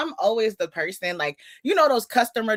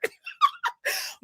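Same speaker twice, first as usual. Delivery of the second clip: high squealing laugh; a sudden high-pitched laugh; high burst of laughter; 3 dB quieter than usual. Real, not acted. Laughing at her own nurse story, high squeal.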